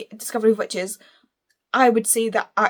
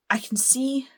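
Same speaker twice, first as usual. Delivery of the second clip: whispered aside; 4 semitones higher, 3 dB quieter than usual. Real, not acted. Aside while comparing covers.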